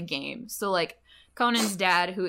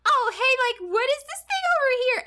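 much higher, fast, hammed-up little-kid voice; fake childish Conan; 5 dB louder than usual. Conan faking being a child to distract, high, fast fake-kid voice. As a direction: play the innocent wide-eyed kid. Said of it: "Conan will be like".

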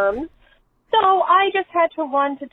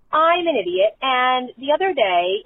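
usual speaking voice

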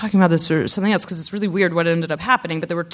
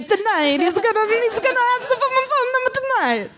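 put-on very high Italian-accented swoon. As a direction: lovestruck girl with an Italian accent. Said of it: "doing an Italian accent".

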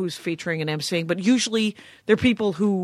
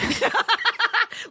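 high embarrassed laugh. Real, not acted. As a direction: burst out laughing. Laughing at own seal bark.

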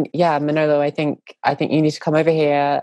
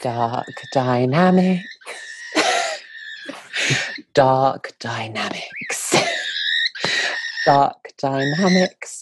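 slow, drawn-out British title chant breaking into laughter; the real British accent version of the jingle, slow and giggly; proper British accent; much slower than usual. Acted. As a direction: intone the show name slowly in a native British accent, laughing between. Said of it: "do it in the proper British accent".

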